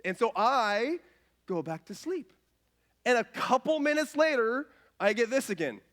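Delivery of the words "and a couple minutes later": ordinary delivery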